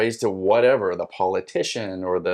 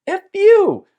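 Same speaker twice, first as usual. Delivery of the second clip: high, loud mock-furious yell; mad; 11 dB louder than usual. Seeker cursing the spirit who made him wait, mad. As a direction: curse it out, voice shooting way up. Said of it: "And I kinda get mad".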